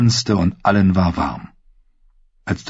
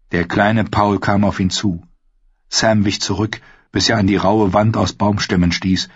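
ordinary delivery, nothing marked

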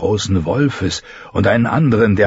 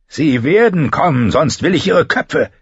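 "werden" hammered home, higher commanding knight; stern insistence; 8 semitones higher than usual. Acted. (strenger Befehl, „werden“ stark betont)

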